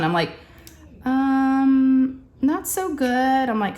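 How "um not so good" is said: high, drawn-out hesitation; re-enacted sheepish stalling, pitched up; sheepish admission. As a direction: reluctantly ease into bad news. Breaking bad news to her husband.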